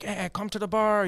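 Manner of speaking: high-pitched Irish bar invitation; Irish accent. Retold or imitated. Irish guy hails his friend to the bar, Irish accent. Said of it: "he's this Irish guy".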